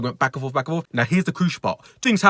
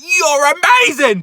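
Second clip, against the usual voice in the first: much higher, louder and slow; shouted compliment; 13 semitones higher, 7 dB louder, much slower than usual. Retold or imitated. Shoplifter hurling compliments mid-argument, angry shout. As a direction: yell praise as if picking a fight. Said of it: "but just shouting".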